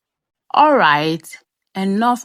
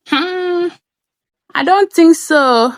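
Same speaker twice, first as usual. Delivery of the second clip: mock doubt; 11 semitones higher, 6 dB louder, slower than usual. Answering her own question.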